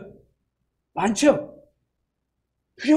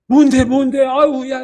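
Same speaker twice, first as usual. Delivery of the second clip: alarmed questioning; 2 semitones higher, 7 dB louder than usual. Retold or imitated. Alarmed listener asking.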